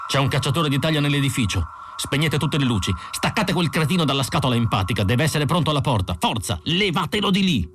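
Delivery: high, urgent snarled orders; snarling. A narrator's reading. Roy warning the others of a hunter, snarling. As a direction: bark commands, rising to a high final cry. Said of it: "Roy Beatty ringhiò".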